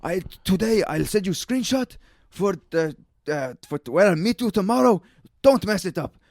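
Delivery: mimicking the French boss's warning; French boss impression. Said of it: "he was like".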